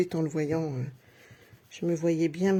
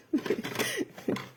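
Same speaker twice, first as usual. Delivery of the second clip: a high, airy, unvoiced little laugh; a breathy giggle; 3 dB quieter than usual. Real, not acted. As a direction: laugh softly at her own compliment. Laughing at her own praise.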